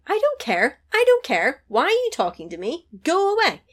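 high, loud snapping "go away"; mock angry woman. Woman storming off from the man who used her, mock anger. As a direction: push him off, upset. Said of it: "Yes, angry".

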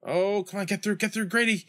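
high, tense pleading with the play; urgent cheering on. Real, not acted. Gamer urging Grady to get through, tense and high. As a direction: urge the runner on, pitched high.

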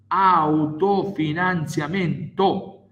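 Autofinanziamento said slowly, each syllable weighted; slow emphasis. Shown as a demonstration. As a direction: spell out Autofinanziamento deliberately. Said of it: "slow emphatic repetition".

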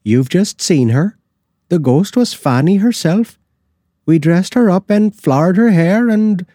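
proud of the prank. A narrator's reading. The cook tells Hegan the ghost was Fanny, high and gleeful.

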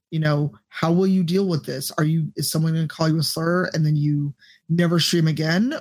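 ordinary talk, nothing marked

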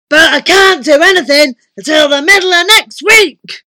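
loud, high giant's voice; booming giant. A narrator's reading. Giant wizard puts off his visitor, booming. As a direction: bellow it all through.

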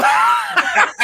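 loud squealing burst of laughter; loud high laugh. Real, not acted. Host bursting out laughing at the guest. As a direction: let it explode out of you.

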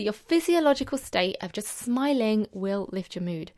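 ordinary talk

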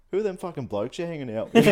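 a light mock of a prying dad; imitating dad. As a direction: fire it off like a nagging father, laughing. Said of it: "He'd be like".

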